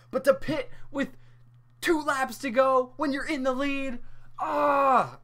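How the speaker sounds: high, loud frustrated outburst. Real, not acted. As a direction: vent the frustration, shout the ah. Frustrated rant, ends in shout.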